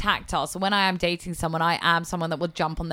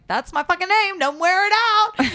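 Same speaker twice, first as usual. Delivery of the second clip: much higher and louder, fed up; exasperated outburst; 11 semitones higher, 4 dB louder than usual. Retold or imitated. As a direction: snap it out, sick of hearing it. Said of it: "so much so that I was like".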